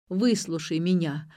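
ordinary delivery

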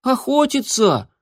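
high squeaky kitten voice. A narrator's reading. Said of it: "пискнул котёнок".

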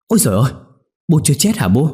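daughter-in-law clinging to her mother-in-law's legs in shock; stammering. Acted. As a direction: blurt it out, half sobbing with relief. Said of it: "lắp bắp".

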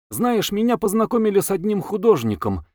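lively, high-pitched chatter; very animated. A narrator's reading. Wife telling her husband about an artist, animated. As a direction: share news eagerly, voice lifted high. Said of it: "очень оживлённо рассказала".

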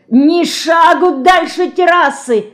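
high, loud, slow shouted order; bellowing. A narrator's reading. Detective roars at Dinka to stay on the terrace, loud bellow. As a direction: roar the order to stay put. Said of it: "заорал он".

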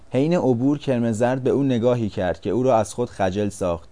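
ordinary delivery

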